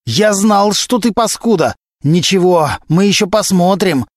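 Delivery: high, threatening outburst; angry yelling. A narrator's reading. Suspect cursing and threatening the investigator, yelling. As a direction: hurl it as a furious threat. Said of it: "опять заорал Глущенко".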